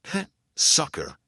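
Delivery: slow, amused chuckle on the words; chuckling. A narrator's reading. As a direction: scoff with a light chuckle. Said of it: "Gaddy chuckled".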